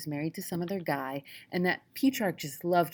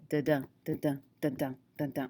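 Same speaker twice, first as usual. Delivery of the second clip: "da-da" beaten out in even, low pulses; iambic rhythm; 3 semitones lower, faster than usual. Shown as a demonstration. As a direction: tap out "da-da" as a steady poetic beat. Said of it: "Meter is that rhythm that you have".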